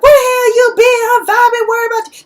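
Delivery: very high, loud furious demand; angry scolding. Retold or imitated. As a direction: yell it like a worried, furious sister. Sister confronts Nova, acted quarrel.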